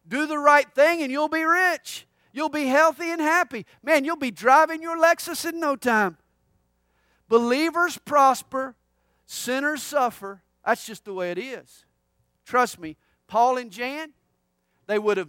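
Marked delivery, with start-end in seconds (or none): 0.1-10.4 higher, louder TV-preacher pitch; mildly hyped-up preacher imitation; mock prosperity preacher. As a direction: lightly parody an upbeat televangelist sales pitch. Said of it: "they preach a kindergarten theology".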